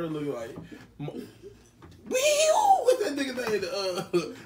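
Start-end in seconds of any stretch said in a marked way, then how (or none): 2.1-2.8 very high siren-like whoop; siren squeal. Real, not acted.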